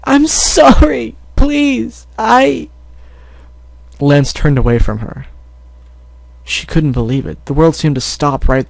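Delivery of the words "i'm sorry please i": much higher, slow sobbing plea; a little girl breaking into tears; crying apology. Acted. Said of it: "couldn't hold back any longer".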